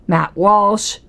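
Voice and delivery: normal talking voice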